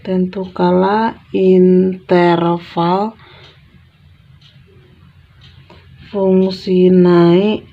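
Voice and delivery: very slow, drawn-out words with long pauses; slow dictation. Real, not acted. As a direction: dictate it word by word, stretching each one. Question repeated slowly, as if writing.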